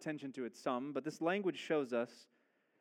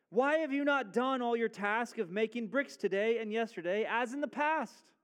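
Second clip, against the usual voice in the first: demanding taskmaster; 6 semitones higher, 4 dB louder than usual. Acted. Taskmasters question the foremen.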